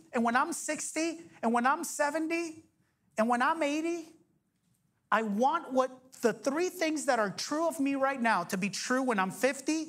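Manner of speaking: ordinary talk, nothing marked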